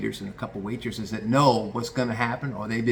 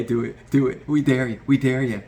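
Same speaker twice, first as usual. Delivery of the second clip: louder chanting crowd of coworkers; egging on; 2 semitones higher, 6 dB louder than usual. Retold or imitated. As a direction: goad him like a crowd.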